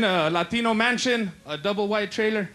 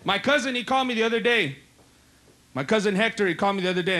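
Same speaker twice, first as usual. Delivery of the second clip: usual speaking voice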